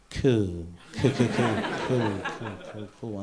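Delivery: low, awkward string of cools trailing off; feigned understanding. Retold or imitated. Patient pretending to follow the doctor's explanation, awkward bluffing. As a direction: cover your confusion, voice dropping away.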